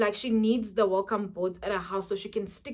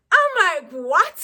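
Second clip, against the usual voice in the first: shocked what; 7 semitones higher, 10 dB louder, slower than usual. Retold or imitated. Retelling shock at tiny boards.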